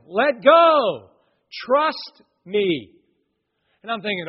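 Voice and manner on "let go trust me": much louder, higher shout from above; retold yell. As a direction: yell down to a frozen climber. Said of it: "hearing him up above yelling".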